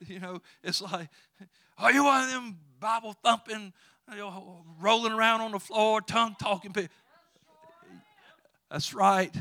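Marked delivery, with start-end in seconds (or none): 1.8-6.9 higher, louder mocking question, half-laughing; hammed-up scoffer sneering at churchgoers; mock incredulous. Skeptic mocking believer.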